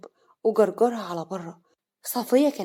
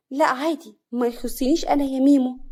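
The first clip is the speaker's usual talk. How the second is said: coy indifference; 5 semitones higher, 5 dB louder than usual. Acted. Flirt feigns indifference to suitor.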